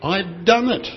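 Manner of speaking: high, victorious announcement; triumphal statement. Acted. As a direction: lift the pitch like a winner declaring success. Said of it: "This is a triumphal statement".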